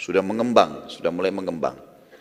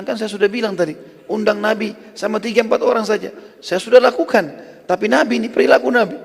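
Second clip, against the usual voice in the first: exasperated wife; 10 semitones higher than usual. Acted. Wife answers Jabir, exasperated.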